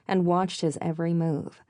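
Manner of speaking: ordinary delivery, nothing marked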